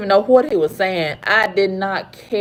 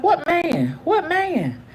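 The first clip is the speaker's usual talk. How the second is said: alarmed hotel clerk; 8 semitones higher, 4 dB quieter, slower than usual. Retold or imitated. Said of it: "he was so alarmed".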